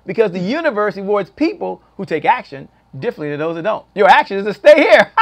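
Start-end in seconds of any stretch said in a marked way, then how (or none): 3.9-5.1 loud, raised-pitch exhortation; boisterous order to the audience; playful command. Real, not acted. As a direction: call it out big to keep them here. Host playfully orders viewers to stay.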